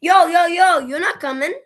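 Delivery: high, loud playful taunt; mock warrior call. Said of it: "Atuma goes like".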